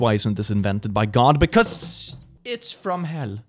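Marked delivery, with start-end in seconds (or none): 1.4-3.4 slow ominous voice. Acted. Ominous punchline.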